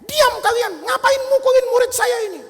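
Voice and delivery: high, indignant shout; Tabrizi shouting. Acted. Master defending his beaten student, shouting. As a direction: shout high to silence a mob. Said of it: "Dia teriak".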